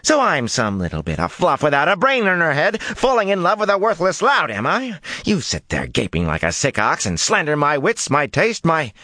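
angry tirade. A narrator's reading. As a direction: rail at him until cut off.

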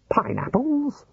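high incredulous question; baffled surprise. Acted. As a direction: squeak it in disbelief.

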